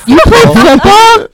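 high, loud, slow disbelief; surprised. Real, not acted. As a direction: drag it out in shock. Surprised at football.

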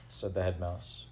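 ordinary delivery, nothing marked

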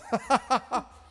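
high, loud jolly laugh; high jolly chuckle; wizard's chuckle. Acted. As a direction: let the wizard chuckle merrily. Wizard laughs after his speech.

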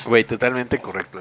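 ordinary talk, nothing marked

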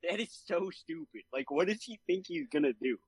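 high-pitched, retold disbelief; lightly acted exasperation; incredulous reaction. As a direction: replay your baffled reaction, pitch climbing. Retells own baffled reaction to film.

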